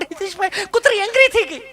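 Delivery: high, panicky mock lady; mimicking scared lady. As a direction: cry out high and flustered.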